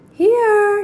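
high, loud answering call. Acted. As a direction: call down from above. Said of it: "she called".

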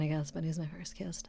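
hushed aside; whispering. Real, not acted. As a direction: whisper it like a secret. Confiding about first kiss.

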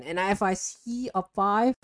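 playfully menacing yandere voice; a hint of a yandere's cheerful threat. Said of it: "me being, you know, yandere".

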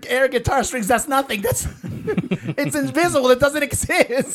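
high giggly laughing voice. Real, not acted. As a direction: laugh through the words. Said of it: "I'm just too excited right now".

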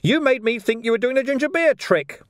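high, wailing accusation; agitated high squeal; distraught protest. Acted. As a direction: protest in a high-pitched whine of betrayal. Ferret wails his accusation.

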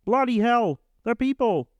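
high, tense and indignant like the psychologist; Jordan Peterson impression. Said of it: "Jordan Peterson".

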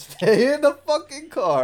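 high, loud, slow laughing yell; mock yell. Friend yelling at the sister to stay in the car. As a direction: bark it at the sister through a laugh. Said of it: "John goes".

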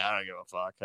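dropped low and soft, barely bothering; muttered aside. Retold or imitated. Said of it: "And I'm just like".

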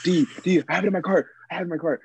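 mock screaming. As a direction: mimic his panic without really yelling. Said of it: "You were screaming".

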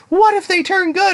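very high-pitched pleading question; imitated naive girl. She asks Goblin Slayer about the babies, mock naive worry. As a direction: jump way up in pitch to mimic her. Girl's worried question, retold.

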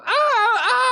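high, faked yelps of pain; imitated kid crying out in pretend pain; mock cries of pain. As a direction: cry "ah" high, as a staged trick. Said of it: "a parlor trick we'd always do. I'd go".

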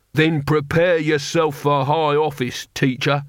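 mild mock shout from an angry barbarian; understated shouted menace; shouted threat. A narrator's reading. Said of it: "Truckle shouted".

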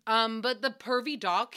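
ordinary talk

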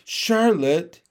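plain reading voice

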